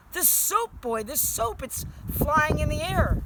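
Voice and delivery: high, loud amazement, stretched out at the end; agog, voice soaring; astonished father. A narrator's reading. Astonished father sees soap floating.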